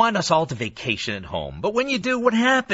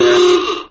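loud mock gasp; 8 dB louder than usual. Mock shock at own joke.

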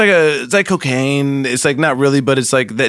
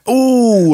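high, drawn-out ooh; held vowel for a dialect drill; coaching demo "ooh"; 6 semitones higher, 4 dB louder than usual. Shown as a demonstration. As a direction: demonstrate a long, high "ooh". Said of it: "Say this".

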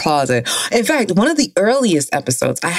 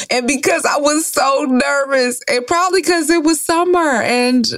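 nervous laugh; 10 semitones higher than usual. Real, not acted. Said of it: "that's a nervous laugh".

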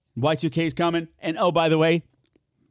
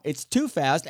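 usual speaking voice

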